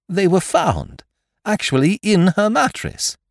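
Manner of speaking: high, loud, disbelieving protest; flustered protest. Acted. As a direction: protest in bewilderment, voice way up. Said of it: "I stammered".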